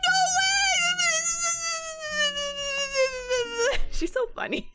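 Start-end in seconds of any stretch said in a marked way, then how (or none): 0.1-0.6 high, loud squeal of disbelief; excited. Retold or imitated. Friend hearing about the tickets, high excited squeal. Friend learns they see Hadestown. 0.6-3.9 high, giddy laugh; high laughter. Real, not acted. Recalling the friend's reaction, giddy high laugh.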